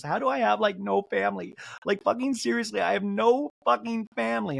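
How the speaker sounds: high, upset impression; mock tearful. Castmate lamenting she has no family, mock tearful. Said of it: "Monica starts crying again".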